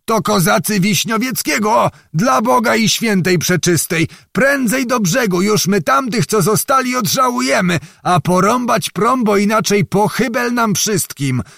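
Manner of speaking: put-on old man faking panic to turn the ferry; feigned terrified yell. A narrator's reading. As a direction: scream like being flayed alive. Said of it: "począł krzyczeć, jakby go kto ze skóry obdzierał".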